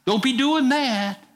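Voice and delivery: Peter pushing back at Jesus, pitched high; Peter protesting. Retold or imitated. Preacher voicing Peter blocking Jesus, high and insistent. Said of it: "tell Jesus".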